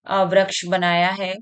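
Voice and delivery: ordinary delivery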